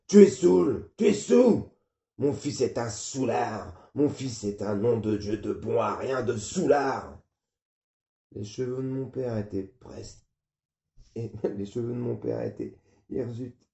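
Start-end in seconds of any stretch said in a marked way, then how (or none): 0.1-7.2 raging father. Acted. Father raging that his son is a drunk. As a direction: build from a shriek into slow contempt.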